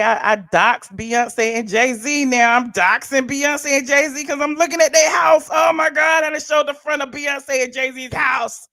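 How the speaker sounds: loud mock outrage at being accused; hammed-up shouting critic. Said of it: "colorist Claudia gonna say".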